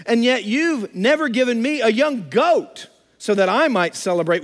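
put-on older son bitterly protesting to his father; angry older son. Older son protests to his father, mildly angry. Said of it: "But he became angry".

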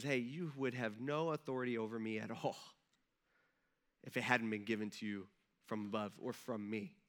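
low, soft, measured reply; quiet authority. Retold or imitated. Bloodied Jesus answering Pilate's threat, quietly authoritative. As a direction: answer softly and low, unhurried.

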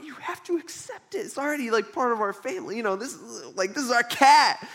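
put-on a kid arguing to keep the cat; whiny kid protest. Kid to mom, pleading high to keep the kitten. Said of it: "as a kid, you're like".

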